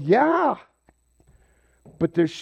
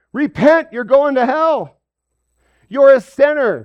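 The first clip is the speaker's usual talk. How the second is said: loud, high condemning shout; mock street preacher; 9 semitones higher, 9 dB louder than usual. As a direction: yell it like a sign-waving preacher. Mocking sign-waving street preachers.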